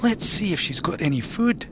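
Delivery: sly hungry idea; suggesting. Acted. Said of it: "Ian suggested".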